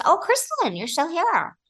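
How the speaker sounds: high, surprised squeal of a crew member. Retold or imitated. Production assistant spotting her late at night, high surprised squeal. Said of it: "a P A comes up and goes".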